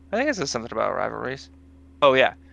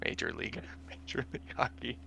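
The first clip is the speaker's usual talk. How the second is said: laughing through words; 12 dB quieter, faster than usual. Real, not acted. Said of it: "that was almost a lead".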